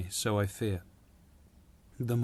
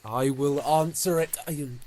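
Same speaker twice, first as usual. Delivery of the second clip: sudden bold outburst; 4 semitones higher, 9 dB louder than usual. Acted. Sudden burst of resolve.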